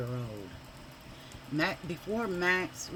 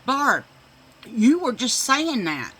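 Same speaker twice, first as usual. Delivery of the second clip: high, loud accusation; calling her out; 7 semitones higher, 10 dB louder than usual. Retold or imitated. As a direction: point the finger with a loud laugh in it. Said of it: "I said".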